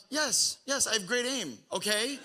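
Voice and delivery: high-pitched, hammed-up defensive retort; mock defensive. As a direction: brush off the complaint in a high kid voice. Acted retort to hurt neighbor.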